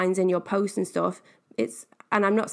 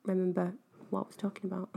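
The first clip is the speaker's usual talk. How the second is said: trailing off; 10 dB quieter, faster than usual. Real, not acted. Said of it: "gone off on such a tangent".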